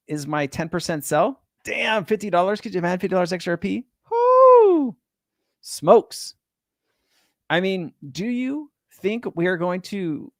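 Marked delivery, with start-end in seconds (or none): 4.1-5.0 excited whoop. Real, not acted. Excited at price dream. 5.6-6.3 excited exclaim. Real, not acted. Excited at price dream.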